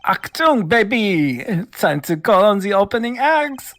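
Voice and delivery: high, swaggering German-tinged rocker voice; show-off rock star with a Euro accent. Acted. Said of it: "your affected Euro rock accent".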